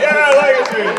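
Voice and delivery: high-pitched mock cheer from one fan; lone cheering fan. Teasing the crowd over one guy clapping, mock fan cheer. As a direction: imitate a single keen audience member cheering. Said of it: "I got one guy clapping".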